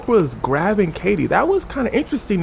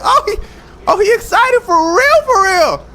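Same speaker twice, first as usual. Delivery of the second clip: mock alarmed yell; 13 semitones higher, 7 dB louder than usual. Oprah watches Tom jump on the couch, astonished yell. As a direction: wail it in exaggerated amazement.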